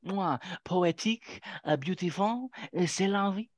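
light mock French accent; airy French accent, kiss included. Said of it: "now you're doing a French accent".

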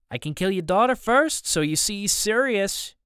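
high-pitched, eager menace; henchman's threat. A narrator's reading. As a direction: offer the threat brightly, pitch climbing. Henchman offers to kill the daughter.

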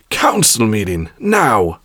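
curt bark into a comm; barked order. A narrator's reading. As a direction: bark it like an impatient boss. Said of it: "she barked into it".

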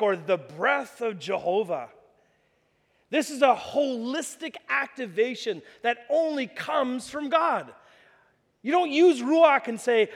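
usual speaking voice

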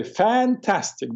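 put-on slow, drawn-out Italian-accented fantastic. Hairdresser Sal answering his client, slow Italian-accented fantastic. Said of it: "he still speaks with an Italian accent".

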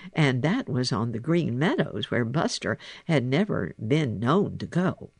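ordinary delivery, nothing marked

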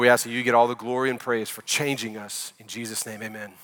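hushed words to God, fading at the amen; prayer. Real, not acted. Pastor asking God to bless the preaching, soft prayer. Pastor prays before the sermon.

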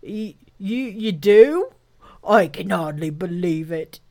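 stammering, amazed rhino voice; halting, astonished creature voice; overcome with excitement. Acted. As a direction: splutter in disbelief, breaking off to gasp. Rhinoceros overcome by good news.

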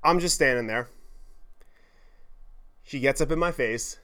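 ordinary talk, nothing marked

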